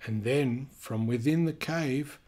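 lively storybook reading voice; animated read-aloud for kids; reading to children. A narrator's reading. Reading picture book aloud.

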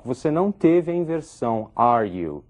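usual speaking voice

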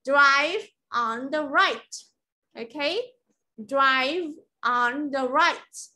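slow Drive on the right, ending in a clear t; teacher repeats the sentence for students to copy; model pronunciation. Shown as a demonstration. Teacher repeats the sentence slowly. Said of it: "cũng là right".

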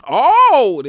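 loud, high outburst of oh; sudden high-pitched shout; loud reaction. Real, not acted. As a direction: cry out at the play. Reacting to a play.

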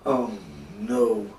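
understated, sinking groan; dismayed groan. Acted. Jonah dreads being thrown in the water, groaning. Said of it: "Jonah groaned at that thought".

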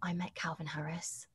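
whisper. Real, not acted. Confessing a star crush.

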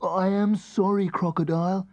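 high little elephant voice, politely. Acted. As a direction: apologise sweetly as a little elephant. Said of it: "said Melba politely".

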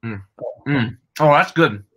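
chewing gum while talking; mouth full. Pretend. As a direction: talk through a mouthful of gum. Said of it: "are you chewing gum".

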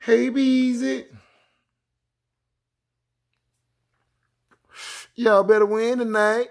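high, slow put-on friend's voice; mock caller voice, high and drawn out; imitating a friend. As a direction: lightly mimic a buddy calling out. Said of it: "But I don't sound like that".

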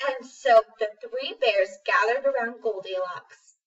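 ordinary delivery, nothing marked

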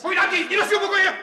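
high, loud parental command; angry order. Acted. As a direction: send the boy off with a shout. Otec vykazuje syna do pokoje. (rozzlobený hlasitý rozkaz)

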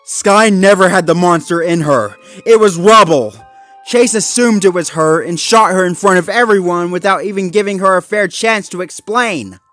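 loud, high, impassioned rant; angry accusation. Acted. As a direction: press the accusation hard. Zuma accuses Chase of murder.

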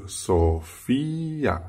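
Sophia stretched slowly, stress on the i. Shown as a demonstration. Teacher slowly showing students Sophia's stressed i. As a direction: draw out so-FI-a. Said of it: "重音在一上面".

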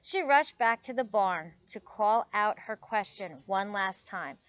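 animated storybook reading with lively pitch swings; story read to children. A narrator's reading. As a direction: read it aloud for little listeners. Reads picture book aloud to children.

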